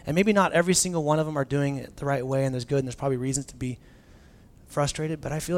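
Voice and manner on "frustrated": ordinary talk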